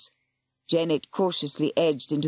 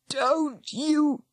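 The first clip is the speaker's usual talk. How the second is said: croaking; 11 semitones higher, much slower than usual. Acted. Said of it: "came a croaking voice".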